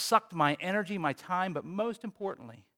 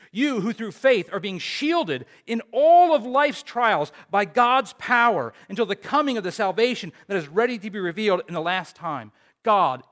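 louder, building scripture reading; preaching scripture; 3 semitones higher, 7 dB louder than usual. Acted. Preacher reading Peter's letter to the congregation, proclaiming it loud. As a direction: proclaim the passage to the church.

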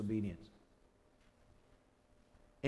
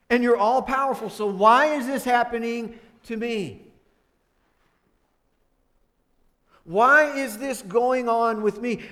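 loud, higher whiny protest to God; complaining to God; 7 semitones higher, 10 dB louder than usual. Retold or imitated. A believer whining to God about circumstances, loud protest. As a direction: voice a believer blaming God for everything. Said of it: "you go".

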